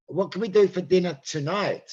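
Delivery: "tonight" singled out as the key word; stress on "tonight". Shown as a demonstration. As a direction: lean hard on "tonight", as a contrast. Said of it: "Not tomorrow, tonight".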